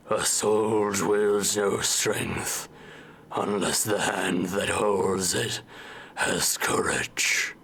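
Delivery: slow, low, grave swordsman voice; serious skeleton voice. Shown as a demonstration. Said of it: "a serious voice".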